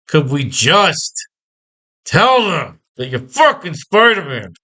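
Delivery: slow, drawn-out mock begging; exasperated plea. Reader begging the comic's characters to just ask, exasperated plea. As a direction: plead in exasperation, stretching the words. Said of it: "You keep yelling and wondering".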